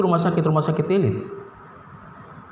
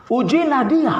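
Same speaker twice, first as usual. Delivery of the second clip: ujilah dia raised high and ringing; emphatic proclamation; 7 semitones higher than usual. Acted. Preacher urging congregation, ujilah dia proclaimed high. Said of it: "perjanjian lama ini menegaskan".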